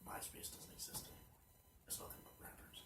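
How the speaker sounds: soft hushed whisper. Real, not acted. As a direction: whisper it under your breath. Hushed closing banter.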